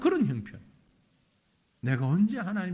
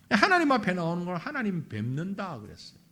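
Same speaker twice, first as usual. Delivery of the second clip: usual speaking voice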